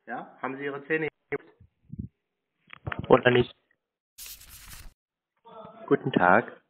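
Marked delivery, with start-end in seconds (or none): none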